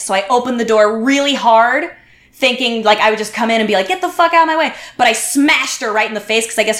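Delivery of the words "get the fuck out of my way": higher-pitched exasperated demand; retold angry order. Planning to burst through the door, retold angry order. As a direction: act out ordering someone aside, pitched up.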